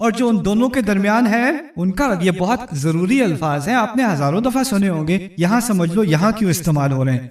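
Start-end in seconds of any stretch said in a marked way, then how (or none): none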